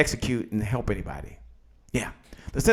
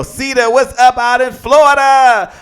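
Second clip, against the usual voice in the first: high, loud shout-out; calling out; 7 semitones higher, 7 dB louder than usual. Real, not acted. Host greeting live viewers by place, loud excited call. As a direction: holler a drawn-out greeting to the viewers.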